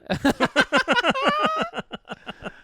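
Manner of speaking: very high, loud shriek of a laugh. Real, not acted. Cohost shrieking with laughter at the slur muptard. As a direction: burst out laughing at the word.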